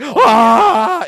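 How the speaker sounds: high, loud comic scream; falling scream. Retold or imitated. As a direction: scream as if shoved out of a plane. Banner screams, pushed out of plane.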